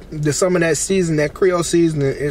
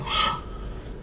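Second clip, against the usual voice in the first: shocked gasp; 11 dB quieter than usual. Retold or imitated. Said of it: "I was looking like".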